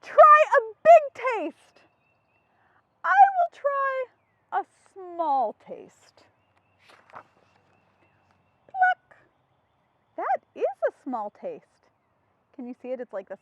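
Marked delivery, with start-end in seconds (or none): 0.0-1.8 loud, slow, high character voice. Acted. Reading picture book character voice. 3.0-6.2 high, slow and loud; character voice. Acted. Reading a picture book to children, a high drawn-out character voice. 8.7-9.1 sound effect. Acted. Sound effect in read-aloud.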